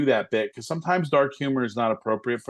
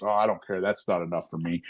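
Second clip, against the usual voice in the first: unimpressed grumble; grumbling; 6 semitones lower, 3 dB quieter than usual. Retold or imitated. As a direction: mutter it like nothing pleases you. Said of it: "always negative about everything".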